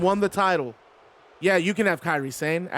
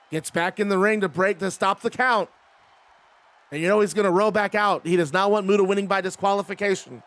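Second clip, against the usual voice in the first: normal talking voice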